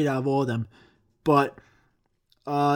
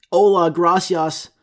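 anglicized Spanish; 2 semitones higher, 4 dB louder than usual. Retold or imitated. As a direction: overdo the English accent on the Spanish words.

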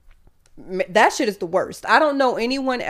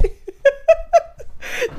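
high squeaky laugh breaking out; a laugh she can't hold in; burst of laughter. Real, not acted. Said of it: "trying not to laugh".

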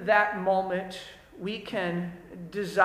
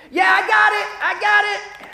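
put-on higher, louder teen yelling back; kid shouting back; 10 semitones higher, 7 dB louder than usual. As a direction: shout an empty promise up the stairs.

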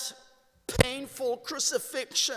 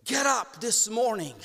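ordinary talk

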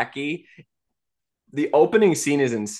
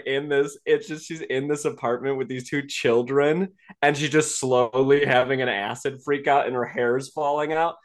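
ordinary talk, nothing marked

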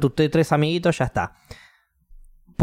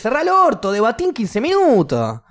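high, loud-edged ranting in outrage; exasperated acted scolding, pitched way up; furious tirade; 10 semitones higher, 3 dB louder than usual. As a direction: tell the kid off at full tilt. Acted rant at spoiling kid.

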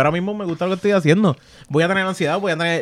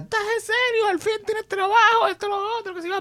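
high, mocking mother's voice; squeaky mimicry of a nagging mother; imitating his yelling mother. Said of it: "mi madre empezó a gritar". (imitando a su madre con voz aguda y chillona)

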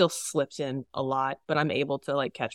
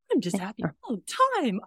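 higher, put-on always-happy voice; mock cheerful; 4 semitones higher, faster than usual. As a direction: ham up a relentlessly cheery person. Mocking always-happy person.

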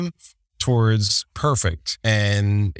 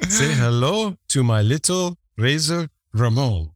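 higher, hammed-up bad fake accent; Razor Ramon; 4 semitones higher than usual. Host mocking a wrestler's bad accent, hammed-up fake accent. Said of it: "guys who can't do an accent, here's Razor Ramon".

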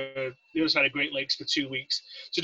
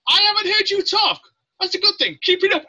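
high, loud chief's voice; mock instructor chief; 14 semitones higher, 6 dB louder than usual. Chief to the silent recruit in line, loud mock approval. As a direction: call it out high and punchy. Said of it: "He's like".

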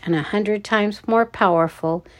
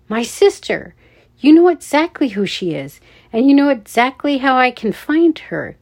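higher, louder accusation that builds; increasingly furious; 5 semitones higher, 5 dB louder than usual. A narrator's reading. Noah confronts the guard about his sister, building fury. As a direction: get angrier with every word. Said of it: "more furious with every word".

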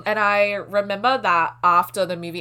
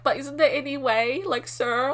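higher-pitched, meek mock pleading; little lamb; 7 semitones higher than usual. Mocking her past self begging the boyfriend, lamb-like plea. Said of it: "like, a little lamb".

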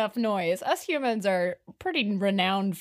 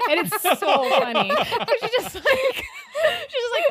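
talking through real laughter, slow and high; helpless laughter; 12 semitones higher, 5 dB louder, slower than usual. Real, not acted. Retelling a funny bear video, helpless laughter. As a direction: struggle to get the words out while cracking up.

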